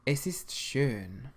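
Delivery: "Es ist schön" slow and clear, ö like burn; careful teacher's pronunciation; slow model answer. Shown as a demonstration. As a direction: say it slowly for learners to copy. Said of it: "how would you say in German".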